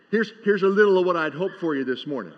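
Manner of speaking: ordinary talk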